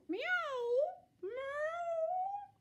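high thin kitten mewing; tiny kitten meow. A narrator's reading. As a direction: mew like a small kitten. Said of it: "they managed to go".

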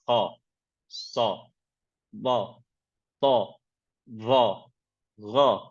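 "ha", "sa", "ba" said with a full, thick a; low, thick-voweled letter names. Shown as a demonstration. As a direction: read each letter with a deep, thick a sound. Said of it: "ga diye a sesiyle okuyoruz".

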